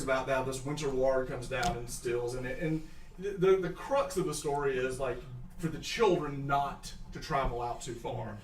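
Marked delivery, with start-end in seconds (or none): none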